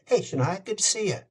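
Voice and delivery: high, chummy hello; casual old-friend greeting. Retold or imitated. Narrator greeting the singer at her door, high chummy hello. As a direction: greet her like you've known her for years.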